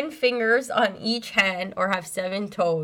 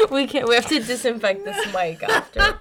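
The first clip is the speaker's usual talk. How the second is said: talking through laughter; laughing; 5 semitones higher than usual. Real, not acted. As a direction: giggle through the words. Laughing fit on mic.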